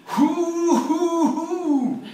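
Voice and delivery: high, loud hooting jeer; ribald mocking hoots. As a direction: hoot at him in mockery. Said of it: "for the most ribald mockery".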